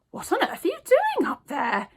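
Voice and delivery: put-on high whispery old lady asking; puzzled old woman peering up. A narrator's reading. Old lady questions newsagent on counter, bemused.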